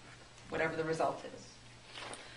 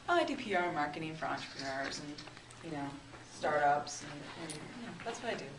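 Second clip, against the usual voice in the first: low-key, quieter, lower mumbled list; boring self-introduction; 4 semitones lower, 7 dB quieter than usual. Shown as a demonstration. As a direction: trail off as if boring yourself. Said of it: "It's kinda boring, actually".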